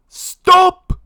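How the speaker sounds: very high, loud shriek; shrieked desperate command; panicked scream. A narrator's reading. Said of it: "screamed Lord Gurr".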